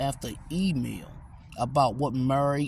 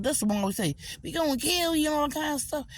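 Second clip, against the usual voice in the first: high, put-on woman's voice; girl down the street; 10 semitones higher, faster than usual. Mocking how the neighbor girl talks, high-pitched. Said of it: "that's how the girl down the street talk".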